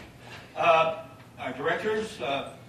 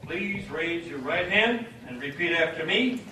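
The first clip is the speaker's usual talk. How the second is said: ordinary talk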